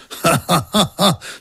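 high scornful royal laugh; haughty king's derisive cackle; mocking laugh. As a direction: laugh the upstart down. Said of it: "smál se král".

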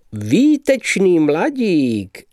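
high, slow enthusiastic praise. Acted. Mr. Justic praising the young man, with high, slow enthusiasm. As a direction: exclaim with delight. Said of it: "zvolal nadšeně pan Justic".

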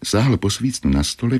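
ordinary delivery

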